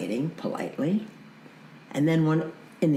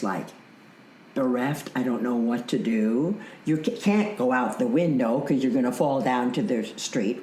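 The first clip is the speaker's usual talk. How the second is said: usual speaking voice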